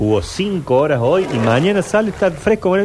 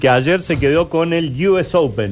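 slow, raised announcer voice; mock news anchor; announcer read; 5 semitones higher than usual. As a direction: read it like a sports bulletin. Radio host news-style read.

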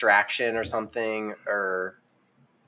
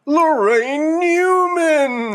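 slow, drawn-out, much higher and louder announcer call; stretched announcer intro; Don Pardo voice; 5 dB louder, much slower than usual. Acted. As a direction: boom the name like a TV show announcer. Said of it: "That's why I did the Don Pardo voice".

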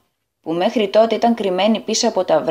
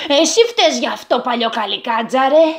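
lightly voiced accusing young man; young man's accusation; 2 semitones higher than usual. A narrator's reading. Young man with tin bump accuses the goblin, mild shout. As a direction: blame the goblin sharply. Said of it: "φώναξε".

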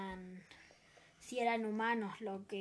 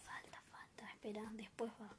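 whispering; 11 dB quieter than usual. Real, not acted. Aside to co-host during show.

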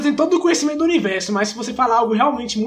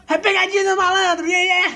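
higher, hammed-up game show host; TV host catchphrase; 7 semitones higher than usual. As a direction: yell it like a prank show host. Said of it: "você fala um".

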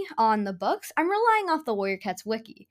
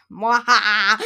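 hammy villain cackle; sneering panto villain laugh; evil cackle; 6 dB louder, slower than usual. Pretend. Evil laugh after revenge plan.

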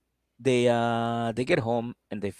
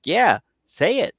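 mock daring; 7 semitones higher, 6 dB louder, slower than usual. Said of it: "He's like".

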